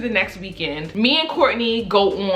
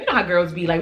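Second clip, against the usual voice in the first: ordinary talk, nothing marked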